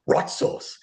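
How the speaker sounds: rot sauce said with an R on the front of rot; Scoobified words. Shown as a demonstration. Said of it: "rot, R O T".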